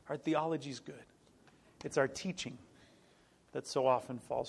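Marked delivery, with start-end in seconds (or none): none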